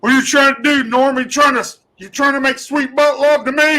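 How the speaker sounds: hammed-up drill instructor tearing into a recruit; imitating a sergeant going off on a recruit; drill instructor yelling. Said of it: "he looks at me and goes".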